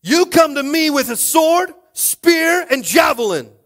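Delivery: high, loud battle shout; defiant David. Acted. David answering the giant Goliath, defiant shout. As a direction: hurl it at the enemy at full voice. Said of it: "David replied to the Philistine".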